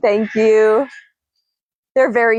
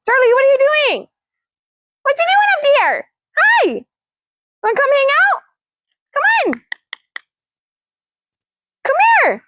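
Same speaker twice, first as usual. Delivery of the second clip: coaxing the bird. Real, not acted. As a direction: call the parrot down in a high, eager pet voice. Coaxing parrot down from tree.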